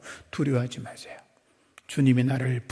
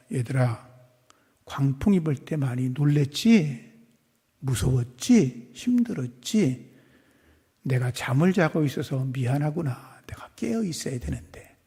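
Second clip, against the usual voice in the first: imagined Jesus apologizing gently, mostly softer; tender comfort; 6 semitones lower, 4 dB quieter than usual. Retold or imitated. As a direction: comfort frightened children softly. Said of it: "이렇게 말해야".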